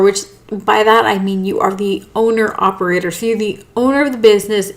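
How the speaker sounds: normal talking voice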